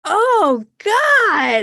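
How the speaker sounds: high, loud appalled "oh God"; disgusted groan. Real, not acted. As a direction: recoil in disgust. Disgust at pickup artist show.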